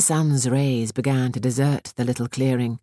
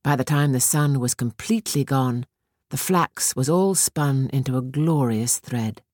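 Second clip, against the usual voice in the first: ordinary delivery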